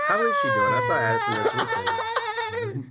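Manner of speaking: very high, airy princess voice; sci-fi princess voice. Acted. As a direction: float it up high like a space princess. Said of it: "It's like the sci-fi princess voice".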